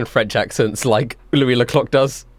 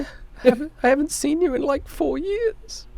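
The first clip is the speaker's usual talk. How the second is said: put-on high, small kid's voice; hurt little kid, slowing down; shaken child; 4 dB quieter than usual. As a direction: trail off softly in a high child's voice.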